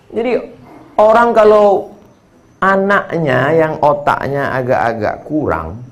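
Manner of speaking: normal talking voice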